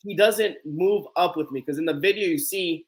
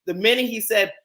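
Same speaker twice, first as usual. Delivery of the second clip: usual speaking voice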